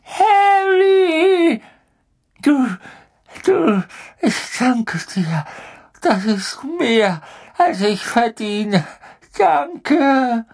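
high sobbing cry then gushing thanks; cling and gush with relief; tearful grovelling. Acted. Pettigrew grovels to Harry, tearful.

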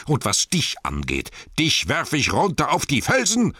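high, loud dwarf tirade; shouted curses. A narrator's reading. Thorin threatens to hurl Bilbo down, shouting. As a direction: curse and threaten at full temper. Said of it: "rief er".

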